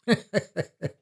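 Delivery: short high hollow laugh; a brittle laugh that falls away. Acted. Trapped friend clinging to the joke, hollow laugh. Laugh during Fortunato's false cheer.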